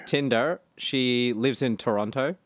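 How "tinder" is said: ordinary delivery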